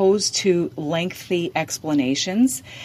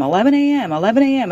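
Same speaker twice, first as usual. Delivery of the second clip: higher, louder, fed-up repetition; exasperated repeating; 6 semitones higher, 5 dB louder, much faster than usual. Retold or imitated. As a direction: drill the time in, at the end of your rope. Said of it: "And I'm so frustrated".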